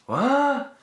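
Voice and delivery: high, loud incredulous what; shocked what. Retold or imitated. Hearing DiCaprio's accent, a stunned what. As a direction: blurt out baffled disbelief. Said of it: "I was like".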